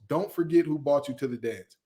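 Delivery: ordinary talk, nothing marked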